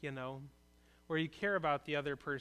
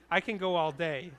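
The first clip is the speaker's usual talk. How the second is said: louder, playful bragging; mock boast; 7 dB louder than usual. Pastor joking to the congregation while screens are down, playful loud boast. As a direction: joke that you could keep going forever. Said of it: "Bad joke".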